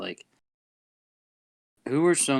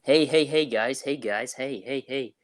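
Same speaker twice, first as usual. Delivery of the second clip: lightly voiced eager wrestler, slightly raised pitch; Chris Sabin; 3 semitones higher than usual. Wrestler calling for the guys' attention, light impression. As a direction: imitate someone repeatedly trying to get attention. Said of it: "What did he say this week to, um, Shira?".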